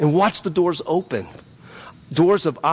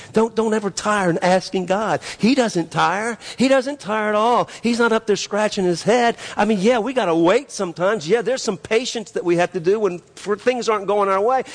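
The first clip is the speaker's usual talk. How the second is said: raised, insistent sermon voice; impassioned, higher-pitched exhortation; fervent preaching; 6 semitones higher than usual. Acted. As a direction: press the point home from the pulpit. Preaches with rising fervour.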